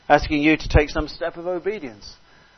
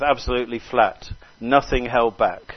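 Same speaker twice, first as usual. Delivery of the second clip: ordinary talk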